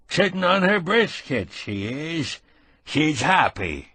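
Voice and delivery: put-on high, slow rural old man, fond memories; a frail old caretaker reminiscing slowly; old farmhand. A narrator's reading.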